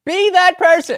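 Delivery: loud, very high echo; mock impassioned cry. Listener mimicking the canvasser's plea, mock impassioned.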